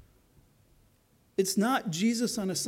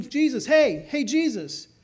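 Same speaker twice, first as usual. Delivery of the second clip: calling out higher and louder, retelling a blind man's cry; mock yell; 5 semitones higher, 6 dB louder, slower than usual. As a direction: shout for Jesus across a crowd, half-acted. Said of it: "The blind man's yelling".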